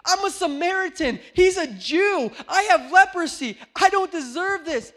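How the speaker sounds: the Samaritan crying out he does not deserve it; humbled leper. Acted. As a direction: cry out as the unworthy healed man. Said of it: "The Samaritan who said".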